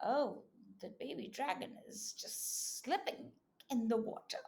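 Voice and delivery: ordinary talk, nothing marked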